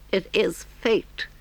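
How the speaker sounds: high, slow dramatic Toad voice; fateful Toad. Acted. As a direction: surrender to temptation in a high, drawn-out voice. Toad talks to himself.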